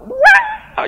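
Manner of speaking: high, loud mimicked shriek; piercing drawn-out "waah"; imitated scream. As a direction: imitate a person suddenly screaming. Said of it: "Yang teriak".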